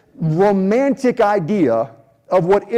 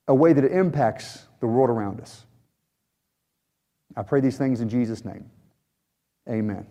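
prayer; 5 semitones lower than usual. Acted. Pastor leads a closing prayer.

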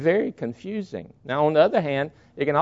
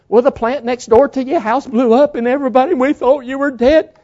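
higher, louder, slowing on the last words; upset outburst; 8 semitones higher, 6 dB louder than usual. Retold or imitated. Daughter telling her mother she feared her dead, loud and upset. Sister berating mother, acted quote.

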